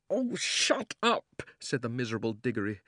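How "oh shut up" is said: dry, strained snap; weary parched voice; dried-up voice. A narrator's reading. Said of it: "still in the same voice".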